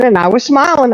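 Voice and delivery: usual speaking voice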